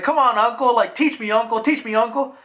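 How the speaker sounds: high, insistent begging; playful, repetitive whiny plea; pestering kid. Retold or imitated. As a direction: pester an uncle like an eager child.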